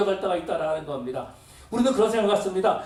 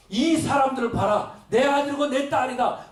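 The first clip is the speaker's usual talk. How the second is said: high, slow proud proclamation; proud boasting God; 7 semitones higher, 4 dB louder than usual. Acted. God boasting to the enemies over the feast, high and proud. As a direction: show off your children with pride. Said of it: "그러면서 자랑하고 싶으시다라는".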